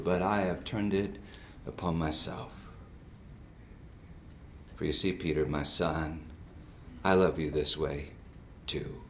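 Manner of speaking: low, measured divine pronouncement; solemn voice of God. Retold or imitated. God solemnly addresses the preacher.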